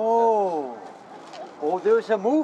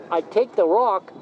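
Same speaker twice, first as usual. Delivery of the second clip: usual speaking voice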